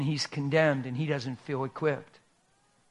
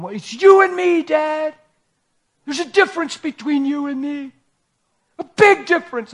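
put-on much higher, loud teenage voice; son's pained protest; 12 semitones higher, 10 dB louder than usual. Teenage son protesting to his father, high and loud. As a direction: cry it out as the hurting boy.

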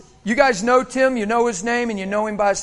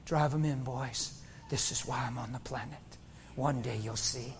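very soft, low, resigned voice; quiet acceptance; 8 semitones lower, 12 dB quieter than usual. Acted. Jesus to the men nailing him, quiet acceptance. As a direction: drop low and hushed, fully willing. Voicing Jesus laid on the cross.

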